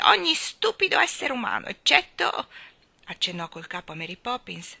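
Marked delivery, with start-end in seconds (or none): 0.0-2.5 high, loud scornful starling; harsh retort. A narrator's reading. Starling lectures the baby on humans, harshly. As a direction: rail harshly at stupid humans. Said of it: "ribatté lo stornello duramente".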